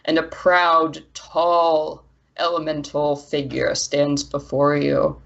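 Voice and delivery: usual speaking voice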